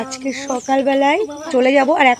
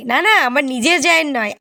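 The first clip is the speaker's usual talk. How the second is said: ordinary delivery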